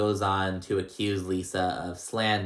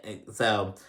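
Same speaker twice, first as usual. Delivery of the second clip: usual speaking voice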